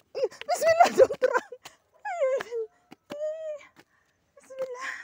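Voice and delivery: loud high alarmed shout; calling out in alarm. Real, not acted. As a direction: yell sharply to stop someone running off. Calling out to running child.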